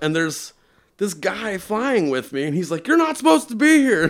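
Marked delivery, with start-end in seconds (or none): 2.9-4.0 high, loud scolding quote; alarmed objection. Retold or imitated. Flying stranger to the intruder, high alarmed objection. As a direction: imitate someone protesting in alarm.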